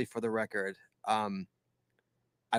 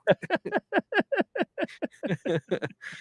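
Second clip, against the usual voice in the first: long high-pitched laugh; high burst of laughter; 3 dB quieter than usual. Real, not acted. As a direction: laugh it off, really tickled. Laughing at listener's comment.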